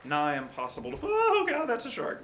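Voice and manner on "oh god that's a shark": high, loud burst of alarm; panicked cry. Real, not acted. Player spots a shark mid-sentence, panicked. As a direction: shoot up high in sudden panic.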